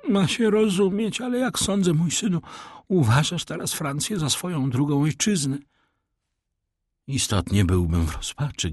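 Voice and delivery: a hint of a pompous old Italian; old major. A narrator's reading. The major bluffing to his fake son, lightly voiced.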